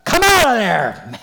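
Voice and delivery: high, drawn-out full-force bellow; enormous roaring call; huge roar. Acted. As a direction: shout it as if calling a man out of a tomb. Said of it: "he roared with a megaphone, with a huge voice".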